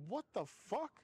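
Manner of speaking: high disbelieving outburst; shocked. Retold or imitated. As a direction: blurt it in shock. Retold shocked reaction.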